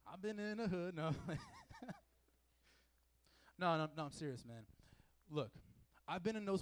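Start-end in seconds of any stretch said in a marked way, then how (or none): 0.1-1.1 laughing. Shown as a demonstration. 1.1-2.0 sudden high burst of laughter. Real, not acted. Laughs at own bit.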